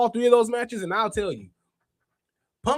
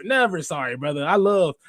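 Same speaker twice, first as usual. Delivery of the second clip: ordinary talk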